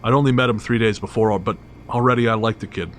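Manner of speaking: plain reading voice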